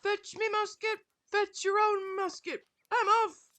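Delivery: high, loud indignant servant shouting; mock butler quitting. Quoting the butler who quit, loud high mock indignation. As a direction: storm off in a huff. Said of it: "the butler that quit".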